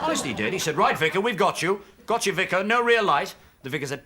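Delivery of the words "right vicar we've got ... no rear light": higher, louder keen village copper; eager copper. Retold or imitated. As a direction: pounce on the vicar gleefully. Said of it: "village copper there".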